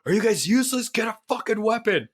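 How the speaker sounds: mock exasperation, voice pushed up high; exasperated yell. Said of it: "And then he's like".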